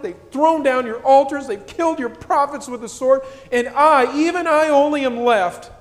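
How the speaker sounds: put-on high, loud whining like a toddler; whiny toddler voice. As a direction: whine it out like a screaming toddler. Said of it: "kind of in a whiny toddler voice".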